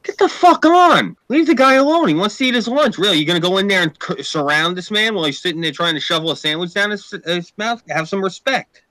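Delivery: yelling at fans crowding a celebrity, fading to a scold; retold yell at crowd. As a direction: bark at the crowd to leave him alone. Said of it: "I'm like".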